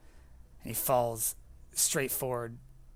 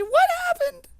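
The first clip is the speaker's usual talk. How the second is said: very high, very loud hysterical demand; screaming; 12 dB louder than usual. Acted. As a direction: shriek it, desperate for an answer. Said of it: "She's like screaming".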